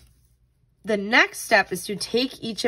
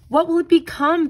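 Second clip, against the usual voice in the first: high, eager question, louder; bursting with curiosity; eager child; 8 semitones higher, 5 dB louder than usual. Acted. Boy asks his parents about the pupa, high and eager.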